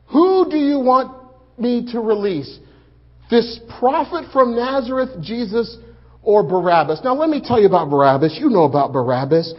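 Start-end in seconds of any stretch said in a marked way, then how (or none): 0.1-7.0 high, loud appeal to a crowd; calling to the crowd. Retold or imitated. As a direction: call the question out over the mob. Pilate calls out to crowd.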